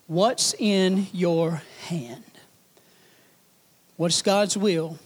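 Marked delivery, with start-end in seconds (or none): 0.1-2.2 drawn out slowly, lower and soft; slow it right down; slow, deliberate. Real, not acted. Slow emphatic refrain.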